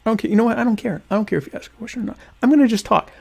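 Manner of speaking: high, fast, dismissive; defiant. Real, not acted. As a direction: brush off the chat defiantly. Defiant rant at chat.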